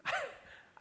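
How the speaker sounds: a high burst of real laughter; big laugh. Real, not acted. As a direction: laugh out loud at yourself. Audience-facing laugh at own story.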